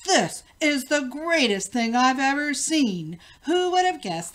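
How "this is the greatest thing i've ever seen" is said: loud delighted cry; joyful shout. A narrator's reading. King shouts to the queen after the machine flies off, overjoyed. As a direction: shout it out while dancing.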